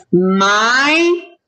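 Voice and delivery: "my" held long with rising pitch; vowel comparison. Shown as a demonstration. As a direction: stretch the long I of "my" as a question. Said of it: "does this sound the same as".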